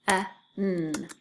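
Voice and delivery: P-e-n as puffed p, short e, held n; P-e-n, sound by sound. Shown as a demonstration. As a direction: sound out P-e-n with gaps. Teacher sounds out word for spelling.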